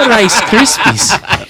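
put-on loud, high, drawn-out Irish accent; super Irish. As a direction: imitate a hammed-up Irish lilt, laughing. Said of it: "super Irish 'cause he's like".

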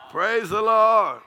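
high, loud, stretched-out shout; exultant praise. Real, not acted. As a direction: call out praise slowly at full voice. Preacher calls out praise.

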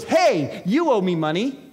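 a creditor shaking down a debtor; mock demanding servant. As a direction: lean on him like a creditor. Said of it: "and he goes".

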